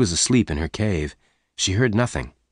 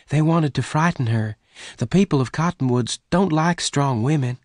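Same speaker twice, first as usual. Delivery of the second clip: higher-pitched woman's voice; Bess, explaining; 7 semitones higher than usual. Acted. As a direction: voice Bess high, explaining earnestly.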